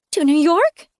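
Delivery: put-on a child hoping to go home; hopeful child. Sam asking narrator, high hopeful child voice.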